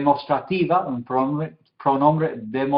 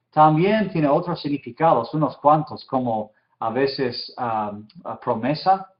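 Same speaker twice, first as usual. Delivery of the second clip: ordinary talk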